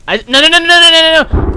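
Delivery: loud, high panicked cries. Real, not acted. Gamer panicking as something attacks, loud and high. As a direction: yelp no over and over in panic.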